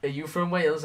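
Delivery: imitating a surprised caller; curious caller. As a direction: perk up like a chatty caller. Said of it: "a lot of people are like".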